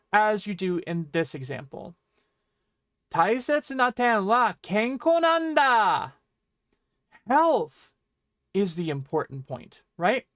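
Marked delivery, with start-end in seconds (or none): 3.1-6.1 loud, high, slow "kenko nanda" at the end; emphasis on kenko nanda. Shown as a demonstration. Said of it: "You're putting the emphasis on". 7.3-7.9 "Health" loud and high, on its own; emphatic HEALTH. Shown as a demonstration. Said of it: "You're putting the emphasis on".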